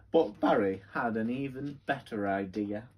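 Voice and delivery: lively, sing-song storybook voice; reading to children. Acted. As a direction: read it like bedtime story time for kids.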